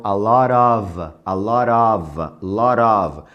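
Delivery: "lot of" with tapped T and of ending in V. Shown as a demonstration. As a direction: repeat "a lot of" slowly, V not F. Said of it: "é of, som de V". ("a lot of" repetido, of com som de V)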